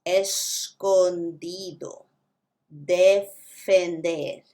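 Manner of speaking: "escondido, defender" said slowly, soft d; "escondido, defender" dheere, naram d ke saath; soft Spanish d. Shown as a demonstration. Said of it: "softer de".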